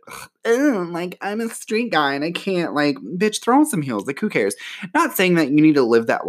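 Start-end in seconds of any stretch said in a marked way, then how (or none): 0.1-2.8 high mocking grossed-out dude voice; disgusted straight guy. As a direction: squeal it like a squeamish bro.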